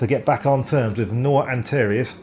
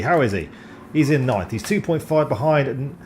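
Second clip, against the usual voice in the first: ordinary delivery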